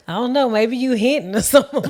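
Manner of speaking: loud laughing quote. Retold or imitated. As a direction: laugh through the words. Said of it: "I was like".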